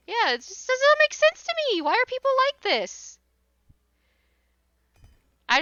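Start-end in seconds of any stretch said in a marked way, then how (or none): 0.1-3.2 much higher, louder disbelieving whine; a much higher, louder "why are people like this" whine; exasperated squeal. Real, not acted. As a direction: throw your hands up in a squeaky voice. Exasperated rant aside.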